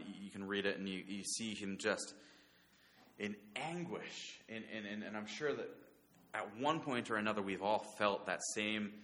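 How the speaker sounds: ordinary talk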